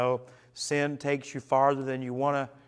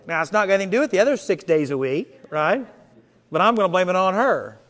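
joking excuse; 3 semitones higher, 7 dB louder, faster than usual. Pretend. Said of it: "I'm just gonna go around and blame".

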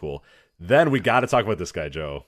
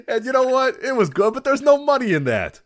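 much higher, louder put-on Chicago Italian tough guy; quoting the wrestler's promo in a fake mobster accent; mock gangster accent; 10 semitones higher, 4 dB louder than usual. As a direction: ham up a wiseguy promo. Voicing Tony D'Angelo's promo.

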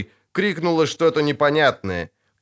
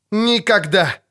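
angry refusal; 8 semitones higher, 3 dB louder, slower than usual. A narrator's reading.

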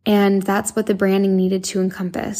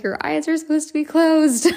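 higher, playful know-it-all reply; teasing retort; 8 semitones higher than usual. Retold or imitated. Host teasing fans about closed eyes, pitched-up playful retort. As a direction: tease them with a pitched-up comeback. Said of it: "I'm like".

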